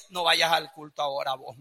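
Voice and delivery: the devil tempting him in the shower; devil's voice. Retold or imitated. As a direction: whisper it like a sly devil. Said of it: "así me ponía el diablo".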